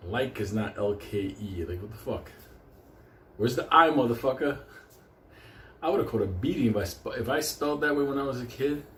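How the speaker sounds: normal talking voice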